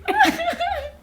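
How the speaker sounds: real giggle at her own text; high laugh. Real, not acted. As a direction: burst of high-pitched laughter.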